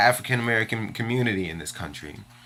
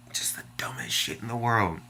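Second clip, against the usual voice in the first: whisper; 3 semitones lower, 6 dB quieter than usual. Real, not acted. Venting disgust at DC.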